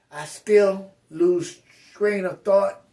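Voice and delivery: usual speaking voice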